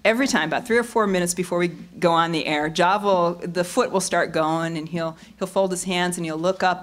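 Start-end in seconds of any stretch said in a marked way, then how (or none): none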